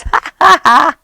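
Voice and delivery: high, loud staged ha-ha; mouse's mocking laugh. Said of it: "Mouse goes".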